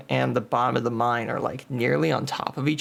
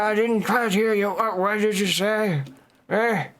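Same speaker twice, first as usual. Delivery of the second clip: put-on higher, croaky deaf old man; 8 semitones higher, 3 dB louder than usual. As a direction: cup the ear, old geezer asking.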